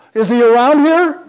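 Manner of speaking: high, loud, urgent question; imitated distraught woman. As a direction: mimic a bewildered woman crying out. Retold distraught woman questioning speaker.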